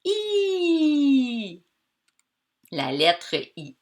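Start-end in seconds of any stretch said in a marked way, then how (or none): 0.0-1.6 "i" high and held very long; isolated vowel sound. Shown as a demonstration. Teacher quizzing pupils on a letter, "i" stretched high. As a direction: sustain "i" as one long ee, higher than speech. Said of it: "Et qu'est-ce qui fait".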